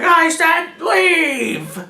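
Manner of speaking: put-on high, slow, cranky old woman yelling; old lady shouting. Old lady with a shotgun ordering intruders off, high mock yell. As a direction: shoo them off like an angry granny.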